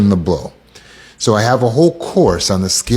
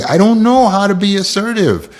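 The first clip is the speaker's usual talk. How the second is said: client's complaint; 10 semitones higher than usual. Retold or imitated. Voices a helpless client asking.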